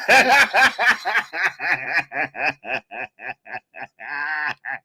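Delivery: long amused laugh; real laugh at the tweet; real laughter. Real, not acted.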